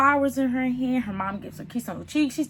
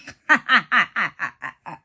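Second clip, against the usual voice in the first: rapid staccato cackle; real laughter; 3 semitones lower, 4 dB louder than usual. Real, not acted. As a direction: burst out laughing, helpless at the mistake. Her own laugh at the bride.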